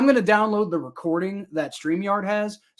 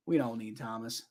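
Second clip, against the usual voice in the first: much softer, lower repeat of "We don't need Thomas"; muttered aside; 6 semitones lower, 11 dB quieter than usual. Acted. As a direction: mumble "We don't need Thomas" under your breath. Repeats line to rival fan.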